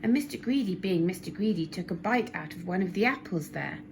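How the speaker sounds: usual speaking voice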